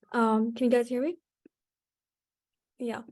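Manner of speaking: ordinary delivery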